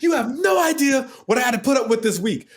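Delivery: high, strained angry yelling; screaming back, pissed. Retold or imitated. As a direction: yell back at your boss, fed up. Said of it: "We're screaming at each other".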